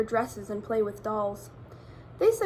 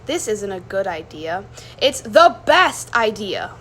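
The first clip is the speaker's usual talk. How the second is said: excited; 3 semitones lower, 9 dB louder than usual. Acted. Amelia answers excitedly.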